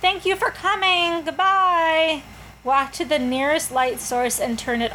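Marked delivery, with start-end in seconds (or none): 0.0-2.2 hostess farewell. Shown as a demonstration.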